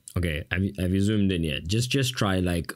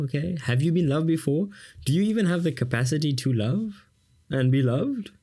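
higher, mock-prying questions; teasing viewer; 6 semitones higher than usual. Teacher teasing viewers about their love life, pitched high. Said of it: "I'm talking to you".

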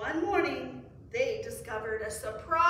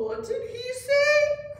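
high, loud, drawn-out question; frogs' astonished cry; 13 semitones higher, 10 dB louder, slower than usual. A narrator's reading. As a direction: cry out slowly in high disbelief. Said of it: "cried the frogs".

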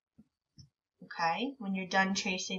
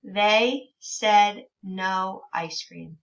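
slow, steady and clear; slow modeled reading; 4 dB louder, much slower than usual. Shown as a demonstration. Teacher reading a page slowly to children. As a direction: lay out each word carefully.

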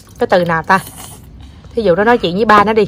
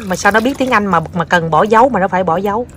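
usual speaking voice

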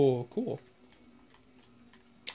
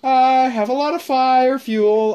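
echoing a game line; 6 semitones higher, 4 dB louder, slower than usual. Retold or imitated. Repeats the game line twice.

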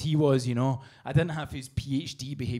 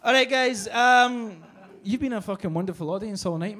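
ordinary talk, nothing marked

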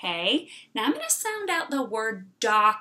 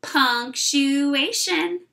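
Punctuation spoken very slowly, each syllable clear; 3 semitones higher, 4 dB louder, much slower than usual. Shown as a demonstration. Teacher modelling a new word: very slow. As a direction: draw it out for the class. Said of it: "Say it with me".

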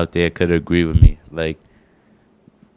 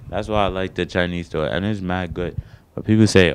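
normal talking voice

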